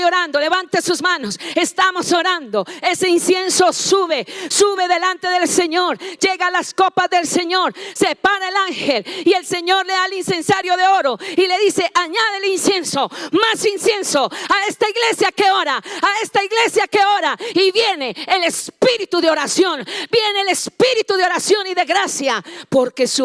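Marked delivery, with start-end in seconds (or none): 0.4-11.9 loud, high fervent preaching; preaching at full force. Acted. Predicación a plena voz. 11.9-17.4 a touch higher, booming divine command; voice of the Lord. Acted. El Señor habla al ángel. 17.5-22.4 loud, high fervent preaching; preaching at full force. Acted. Predicación a plena voz.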